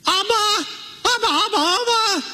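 put-on very high, piercing little-girl cries; shrill child's screaming. Little girl calling her father at the pool, shrill screaming. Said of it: "She's just screaming so shrill".

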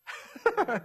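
short high laugh; amused laugh. Real, not acted. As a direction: laugh at his boldness. Preacher laughs at the bold beggar.

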